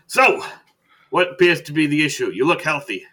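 booming hello, easing on the name; loud greeting. Acted. Doctor introducing himself to a new patient, very loud. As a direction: burst out high and loud as the hearty doctor.

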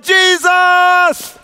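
high, loud cry of Jesus; full shout of praise. Shown as a demonstration. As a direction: lead the crowd in a shout. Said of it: "can somebody just shout".

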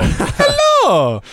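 high, loud cartoon plumber greeting; Mario voice. Comic still greeting the host as Mario. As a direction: squeak it out like a video game hero.

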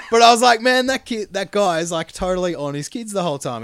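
high, loud and laughing through the words; a new dad cracking up at himself. Real, not acted. Dad laughs to friends about watching as a father, high and loud.

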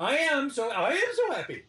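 overjoyed, voice lifted high; giddy joy. Retold or imitated. Joyful retold realization.

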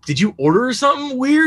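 high, loud bewildered question; puzzled question. Retold or imitated. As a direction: sound baffled at his plate. Asking Brian about the burger.

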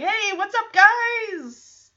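high, bright mock TV-host greeting; Joey greeting. Joey greeting the kids in a high mock host voice. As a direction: play the goofy uncle saying hi. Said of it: "Joey's like".

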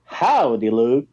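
high, singsong fake American twang; hammed-up American Southern accent; bad American accent. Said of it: "in this very bad American accent".

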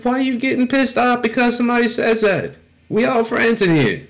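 high-pitched, indignant retelling of an argument; heated protest. Retold or imitated. Telling friends about arguing with Chuck, heated protest. Acted quarrel in retold story.

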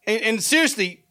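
ordinary talk

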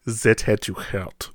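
soft, slow movie quote; imitated pained understatement. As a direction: say it slowly and quietly, as if holding in pain. Acted quote after being stabbed.

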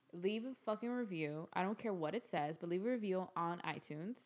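usual speaking voice

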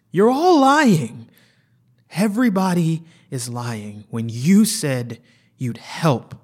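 very high, loud, slow scream; anguished scream. Acted. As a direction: wail it out betrayed. Patient breaks down screaming.

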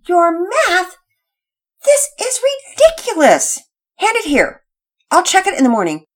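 incredulous mother. Acted. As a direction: throw up your hands in disbelief. Mother discovers undone homework.

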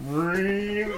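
drawn-out droning "riiing"; imitated film score. Podcaster mimicking Blade Runner music, sustained ringing drone. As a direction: stretch each ring into a long held note. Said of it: "it just goes".

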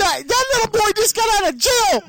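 high shout of alarm; shocked shout. Retold or imitated. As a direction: yell it out in horror. Mom shouting at news.

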